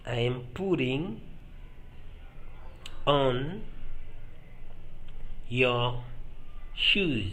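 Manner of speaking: long pauses before "on", "your" and "shoes"; "I am putting", then "on", "your", "shoes" one at a time; word by word. Shown as a demonstration. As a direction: set "on", "your", "shoes" each apart. Teacher models phrase word by word.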